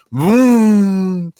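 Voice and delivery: high, loud imitated car engine; engine vroom. Driving away from the jail, loud vroom noise. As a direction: rev it playfully with the voice.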